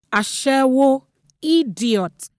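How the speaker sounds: high, loud, furious name-calling; angry woman shouting abuse; yelled insults. A narrator's reading. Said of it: "she yelled".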